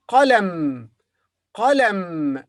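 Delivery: qalam, back-throat qaf; Arabic qalam. Shown as a demonstration. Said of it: "teacher models the word".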